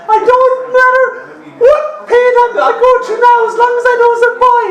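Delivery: very high strained woman's voice, rushing; mother's falsetto. Retold or imitated. Mother in labour pleading to the doctor in a high falsetto. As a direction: squeal it out in a high rushed falsetto.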